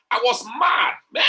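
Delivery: a burst of joyful shouting; excited. Real, not acted. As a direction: shout it out as if you can't hold back the joy. Retelling joy at a testimony.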